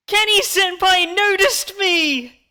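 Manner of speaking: squealing fangirl, high and loud; excited anime fan. Real, not acted. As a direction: gush it out like a starstruck weeb. Fan gushing about being noticed.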